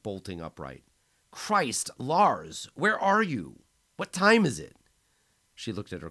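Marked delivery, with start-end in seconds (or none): 1.3-4.8 much higher and louder, panicked; frantic worry down the phone; startled friend, voice shooting up in alarm. A narrator's reading. Woken by injured friend's call.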